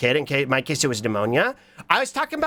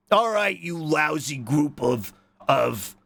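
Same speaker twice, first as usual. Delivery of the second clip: bossy boss; 3 semitones lower than usual. Pretend. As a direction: play at ordering the crew about. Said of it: "instead of bossing around".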